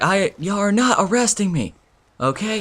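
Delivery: high-pitched mock defiance; imitating defiant girl. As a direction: play the teen refusing the police. Girl defies the arresting cops.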